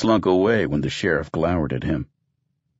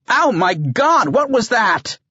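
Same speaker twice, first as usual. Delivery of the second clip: high, shrill panicked cry; a sudden high shriek of alarm; startled shriek; 5 dB louder, slower than usual. Acted. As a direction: yelp it out as if jabbed. Malamar startled by sudden injection.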